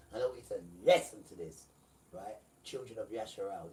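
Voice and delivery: ordinary talk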